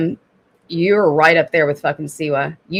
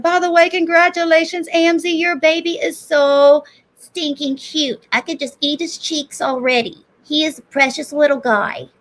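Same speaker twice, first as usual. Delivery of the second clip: put-on baby talk; 8 semitones higher than usual. Cooing to Amzi about his cute baby. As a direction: coo over the newborn like a doting aunt. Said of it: "That's how I talk to my son".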